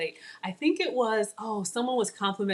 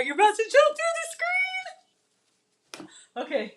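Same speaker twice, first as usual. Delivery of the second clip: excited. Real, not acted. Hyping viewers about the dish.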